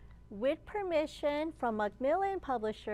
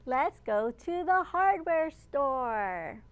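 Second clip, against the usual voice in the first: put-on reading to children; 3 semitones higher, 7 dB louder, much slower than usual. Reads title to children.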